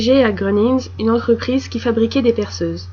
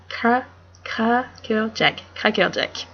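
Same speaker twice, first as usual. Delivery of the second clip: stumbling through crackerjack in a French accent; retry the word with a French r; halting French-accented attempt; slower than usual. Shown as a demonstration. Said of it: "And with the French accent".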